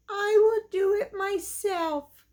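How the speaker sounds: a weary mother hen doing it herself; tired little red hen. Acted. Tired hen decides alone.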